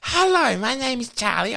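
high loud rushed opener; restarting the pitch, panicked by the clock; frantic restart. Pretend. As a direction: blurt the greeting under time pressure.

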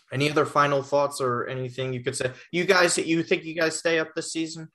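ordinary delivery

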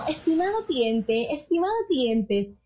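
slow, syrupy mock cashier tone; singsong supermarket cashier voice; hypocritical cashier voice. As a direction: drag out each word like a fake-polite cashier. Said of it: "siempre hablan así".

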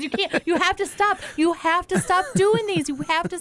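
high, pleading husband voice; imitated begging. Husband asking prankster wife to stop, begging imitated. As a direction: plead desperately, hamming it up. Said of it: "like, begging me".

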